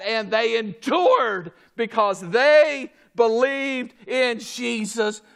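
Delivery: insistent preacher cadence, starting at a shout; full-force preaching. Acted. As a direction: hammer each point home to the congregation.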